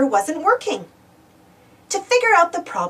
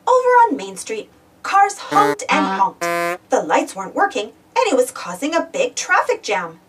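usual speaking voice

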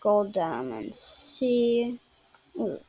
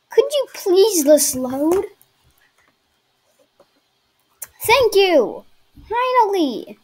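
loud, higher, exasperated pleading; 8 semitones higher, 6 dB louder than usual. Real, not acted. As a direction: beg the game to load, then cheer. Exasperated at slow loading.

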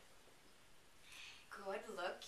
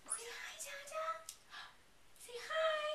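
put-on high lilting say hi to a toddler; sing-song baby talk; 12 semitones higher than usual. Urging the toddler to greet Dada, high sing-song.

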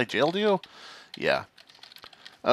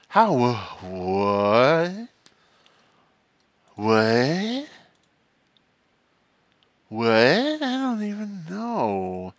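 baffled; much slower than usual. Real, not acted. Baffled reaction to the role reveal.

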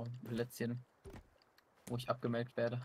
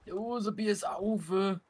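slow, higher goofy voice; slow put-on voice; 7 semitones higher, 5 dB louder, much slower than usual. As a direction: drawl it out goofily.

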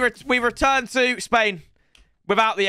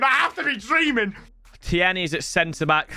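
ordinary talk

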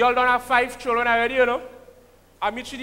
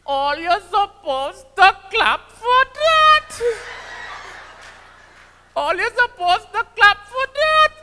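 high, loud mock indignation; [name] demanding applause; 9 semitones higher, 3 dB louder, slower than usual. Comedian demanding applause from the audience, high and loud. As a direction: scold the crowd into clapping. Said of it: "That is my boy".

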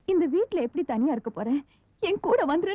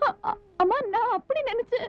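broken, tearful stammer, pitched higher; stammering through tears; 5 semitones higher than usual. Acted. Daughter crying to her mother, stammering through tears. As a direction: choke out the words while crying.

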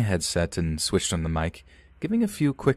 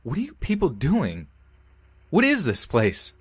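panicked shout; 9 semitones higher than usual. A narrator's reading. Horrified gamer shouting down his mic at the chanting cult. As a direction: yell in horror at the cult. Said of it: "I shouted something along the lines of".

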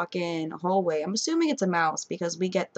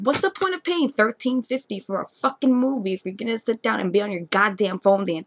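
ordinary talk, nothing marked

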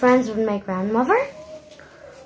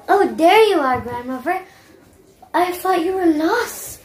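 excited relief; 6 semitones higher than usual. Acted. Girl relieved finding grandmother.

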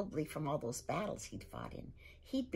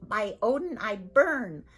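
loud, much higher disgusted Viking roar; 8 semitones higher, 8 dB louder than usual. Acted. Viking father mocking his son's polite words, disgusted roar. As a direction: spit the words back in scorn. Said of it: "screwed up his face in disgust".

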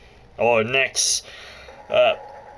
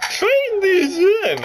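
pitched way up and slow; high put-on lady guide voice; slower than usual. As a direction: redo it as the cheery game guide. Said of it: "I hate talking in that voice".

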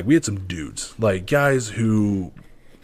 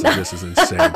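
laughing disbelief; 6 dB louder than usual. Real, not acted.

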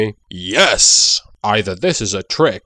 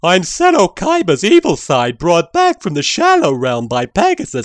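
high, louder hammed-up villain; high villain reveal; 6 semitones higher, 4 dB louder than usual. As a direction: announce it big and high.

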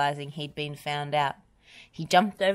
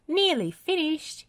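high, raised call; singing out from a distance, pitched up; calling across; 12 semitones higher than usual. A narrator's reading. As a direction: call to someone across the road. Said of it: "he called across to Bob".

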